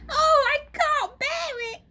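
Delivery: put-on high, loud, upset old wife; Mrs Twit crying out. Old wife cries out to her husband, high and alarmed. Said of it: "cried Missus Twit".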